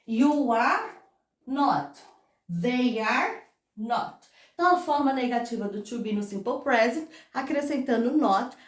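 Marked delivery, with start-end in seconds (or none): 0.1-4.3 slow classroom model; slow and careful. Shown as a demonstration. Teacher showing the class the negative, slow and careful. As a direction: lay out each word slowly. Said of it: "E serve pra todas essas estruturas".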